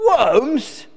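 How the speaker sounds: high, loud disgusted disbelief; appalled eagle exclamation; crying out. Acted. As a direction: cry out in shock. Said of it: "cried Glen".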